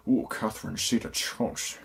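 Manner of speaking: soft put-on movie voice; horrible impression. Reviewer riffing on Entrapment, soft and badly done impression. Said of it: "That was a horrible impression".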